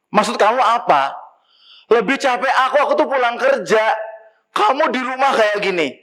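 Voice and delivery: high, indignant husband's comeback; heated retort. Acted. Husband quarrels with wife. (suami tersinggung, membalas dengan nada tinggi)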